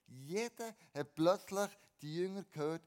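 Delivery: ordinary talk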